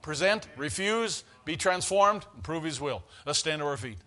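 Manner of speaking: ordinary talk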